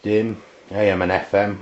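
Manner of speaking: ordinary talk, nothing marked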